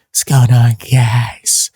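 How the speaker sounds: strained, raspy greeting. Real, not acted. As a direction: rasp through a tired voice. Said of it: "my raspy voice?".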